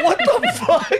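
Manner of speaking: high, laughing what-the; shocked laugh at a silly dish; cracking up in mock shock; laughing disbelief.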